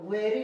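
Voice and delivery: वेरी as "ve-ree" for wire; wrong reading of wire. Shown as a demonstration. Teacher shows how not to read wire, mistaken वेरी. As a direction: misread wire as वेरी with a final "ee". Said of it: "नहीं पढ़ेंगे".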